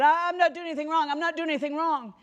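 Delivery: high, fast, panicked pleading; a scared woman insisting she did nothing wrong, fast and high; frightened denial. Retold or imitated. Marie panicking, fearing her visitor is the police. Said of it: "And she said".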